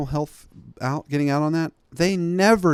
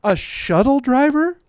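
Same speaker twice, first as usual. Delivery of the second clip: loud, high incredulous repeat; shocked disbelief; 12 semitones higher, 6 dB louder than usual. Real, not acted. Telling the crowd about the DA, shocked and high. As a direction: blurt it out, stunned.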